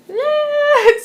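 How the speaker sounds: high, loud drawn-out noise; tongue-tied outburst. Real, not acted. Teacher tangled between English and Japanese, high loud outburst.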